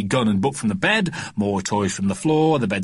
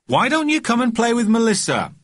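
high, loud call from below; 12 semitones higher, 4 dB louder than usual. A narrator's reading. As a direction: shout up the stairs. Mother calling upstairs.

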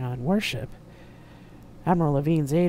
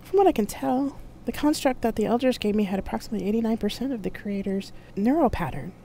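higher-pitched AI explaining; AI companion Loki; 7 semitones higher than usual. A narrator's reading. AI answers Carol's question, in a high explaining voice.

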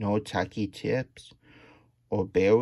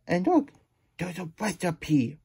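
gasping surprise; 6 semitones higher than usual. Real, not acted. Spots the recipe.

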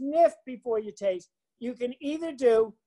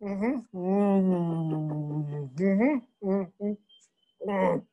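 humming while swishing wine round the mouth; swishing hum; 4 semitones lower, much slower than usual. Shown as a demonstration. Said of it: "Do it with me".

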